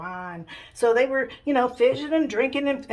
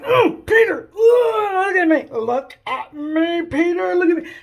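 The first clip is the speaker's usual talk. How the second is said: loud, high, strained plea; alarmed, insistent mock cry; urgent calling; 8 semitones higher, 8 dB louder, slower than usual. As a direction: holler to grab his attention, drawing it out. Jesus urgently calls sinking Peter.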